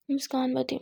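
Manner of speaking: ordinary delivery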